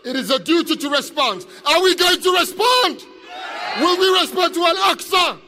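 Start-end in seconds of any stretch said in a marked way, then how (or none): 1.7-5.4 high, forceful questions to a crowd; rallying shout. Real, not acted. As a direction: demand an answer from the audience.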